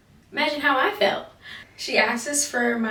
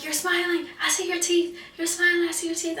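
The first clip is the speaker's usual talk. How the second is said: high-pitched mimicked scolding; mock an accuser in a raised, insistent pitch; high, nagging imitation; imitated accusation; 9 semitones higher than usual. Retells instructor's accusation.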